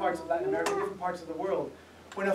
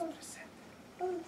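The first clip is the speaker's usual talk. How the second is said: soft whispered Presente; 10 semitones higher, 14 dB quieter than usual. Retold or imitated. Son whispering Presente at the Senate roll call, hushed. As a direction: breathe the word Presente under your voice. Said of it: "He starts to whisper these words".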